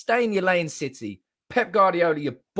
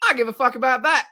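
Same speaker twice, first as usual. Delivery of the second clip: higher and louder, hitting I; excited outburst; 7 semitones higher, 5 dB louder, faster than usual. Real, not acted. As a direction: burst out with the correction. Correcting himself emphatically.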